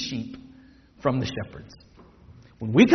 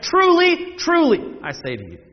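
first "Truly" pitched very high, then dropping; high ringing "Truly" to seize attention; attention-catching emphasis; 9 semitones higher than usual. Shown as a demonstration. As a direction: call out "Truly", then let the rest fall. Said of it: "Jesus means for it to catch your attention".

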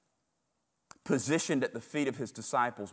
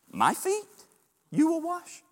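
much higher, squeaky disbelief; high-pitched Peter; 6 dB louder than usual. Acted. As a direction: shoot the voice way up in shock. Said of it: "He says it high pitched like that too".

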